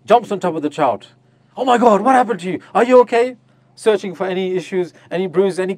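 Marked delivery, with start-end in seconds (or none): 1.6-3.4 high, loud panicked fussing; worried mother. Retold or imitated. Mother panicking over her fallen child, high and loud. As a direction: gush over the child in alarm.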